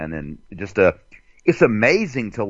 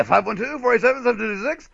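high, fast call-out number; hyped call-in number; radio host style; 12 semitones higher, faster than usual. Shown as a demonstration. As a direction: rattle the number off like a shock jock. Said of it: "I gotta say it like".

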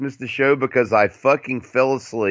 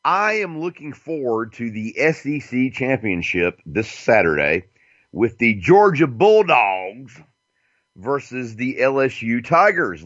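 ordinary delivery

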